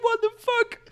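very high, loud squeaked disbelief; incredulous squeal. Retold or imitated. Owner stunned by neighbor's order, high incredulous squeal. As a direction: squeak it out in comic shock.

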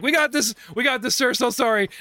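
high, loud hurried placating; nervous high voices. Acted. Frightened vendors placating the big orc, high and loud. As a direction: back off fast, eager to please. Cowed gentlemen backing off.

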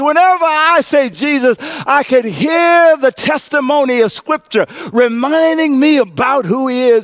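rising call and response; preaching at full force. Real, not acted. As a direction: whip the church up.

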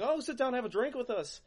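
put-on high, cheery drunk invite; imitating rowdy Irish drinkers.

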